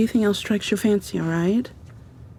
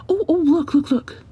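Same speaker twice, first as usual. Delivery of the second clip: sudden bright "oh, look, look" jumping higher and louder; excited; 9 semitones higher, 3 dB louder than usual. Acted. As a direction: spot something you love and point eagerly. Spots cold noodles on menu.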